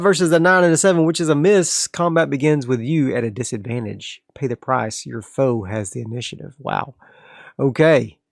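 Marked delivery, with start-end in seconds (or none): none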